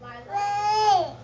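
drawn out, high and very loud, falling; long loud call. Shown as a demonstration. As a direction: stretch the name out like calling across a yard. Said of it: "Say Lila".

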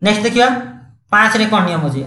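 ordinary delivery

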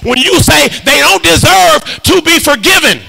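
high, stretched-out preaching cadence; impassioned preaching. Real, not acted. Preacher urging congregation toward pleasing sacrifice, impassioned. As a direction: ring out the key words slowly.

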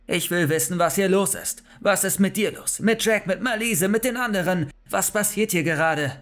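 high, heated demands; angry yelling. A narrator's reading. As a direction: fire off furious questions, demanding answers. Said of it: "brüllte ich".

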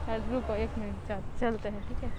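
muffled behind fabric mask; mask-covered mouth speech; through a cloth mask. Real, not acted. Seen 0: Checked cloth mask covers her mouth and nose through all frames.

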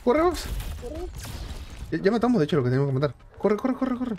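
being chased. Real, not acted. Fleeing enemies in game.

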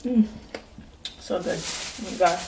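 speaking through closed lips; talking while chewing. Real, not acted. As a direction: mouth full of food. Seen 1: Just bit corn at -1; lips closed, looking down while speaking.

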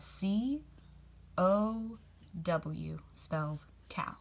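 slow, whispered spelling. Shown as a demonstration. Teacher whispering the spelling of cow slowly. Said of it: "Can you say that with me".

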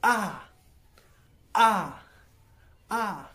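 "आ" very high and loud, falling hard; fourth tone demo. Shown as a demonstration. Teacher modelling the sharp falling fourth tone for students. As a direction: strike "आ" down sharply from the top, Mandarin fourth tone. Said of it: "and the fourth tone".

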